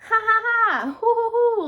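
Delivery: high, loud mock laugh; gloating piggy ha ha; pigs laughing. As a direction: ham up the pigs' gleeful laugh. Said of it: "laughed the two little pigs".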